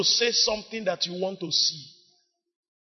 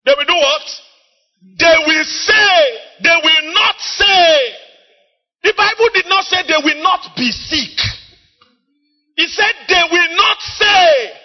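full-force preaching; 8 semitones higher, 8 dB louder than usual. Real, not acted. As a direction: drive the scripture home to the congregation.

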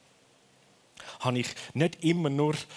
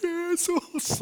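panicked cry; 3 dB louder than usual. Retold or imitated. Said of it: "Bis ich erst in Panik rufe".

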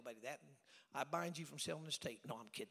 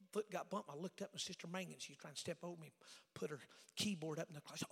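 usual speaking voice

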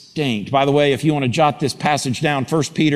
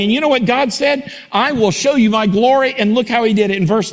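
rising preacher fervor; 6 semitones higher than usual. Acted. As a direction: climb to a passionate cry. Preaching rises to full force.

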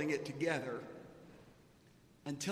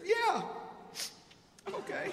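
choked up; 13 semitones higher than usual. Retold or imitated. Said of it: "are you crying?".

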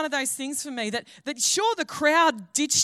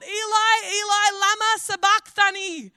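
loud, high, drawn-out cry; anguished yell; 7 semitones higher, 6 dB louder, slower than usual. Acted. Jesus crying out from the cross, yelling. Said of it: "And he yells out".

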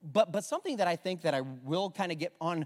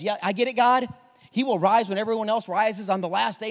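louder, higher insistence; emphatic Martha; 4 semitones higher, 9 dB louder than usual. Acted. Preacher voicing Martha answering Jesus, loud and insistent. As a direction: push it out, sure of herself. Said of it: "she says".

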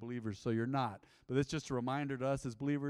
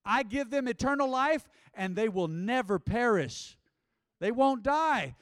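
much louder and higher, slow; full-force preaching; 8 semitones higher, 8 dB louder, slower than usual. Real, not acted. Preacher proclaiming Jesus' promise to the church. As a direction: let the promise ring out.

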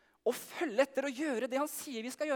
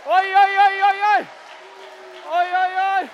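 high, very loud excited oi-chant; excited cheering; 9 semitones higher, 13 dB louder than usual. Real, not acted. Leader whipping up the crowd, excited loud chant. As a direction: whoop it up as he runs.

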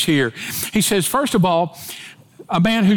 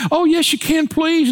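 put-on begging boyfriend; 10 semitones higher than usual. Boyfriend pleading with his date on the porch, high begging voice. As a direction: wheedle her, won't take no. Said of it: "I begged".

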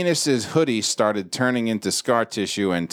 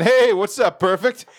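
high, louder hammed-up hello; mock cheery greeting; 10 semitones higher, 4 dB louder than usual. Imitating the greeting at the doctor's, mock cheery. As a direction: call it out high, teasingly. Imagines staff greeting him.

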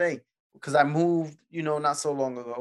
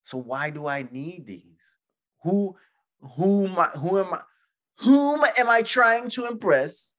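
exasperated self-questioning; 6 semitones higher, 5 dB louder than usual. Retold or imitated. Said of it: "I'm like".

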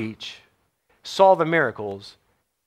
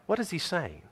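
the question repeated much softer; quiet; 11 dB quieter than usual. Shown as a demonstration. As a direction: drop it to a hushed repeat.

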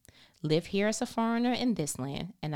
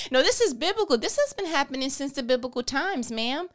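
ordinary delivery

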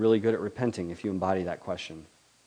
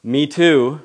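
wry divine comeback; 4 semitones higher, 10 dB louder than usual. Acted. God's wry reply.

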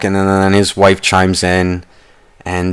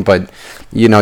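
ordinary delivery

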